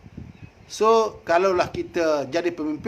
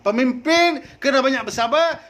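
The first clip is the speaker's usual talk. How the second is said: higher and louder, rising on pemimpin; a preacher's raised, insistent restatement; raised emphatic repeat; 6 semitones higher, 4 dB louder than usual. Shown as a demonstration. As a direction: drive the point home to the crowd. Same phrase repeated, louder.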